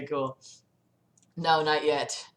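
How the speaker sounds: ordinary delivery, nothing marked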